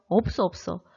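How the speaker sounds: normal talking voice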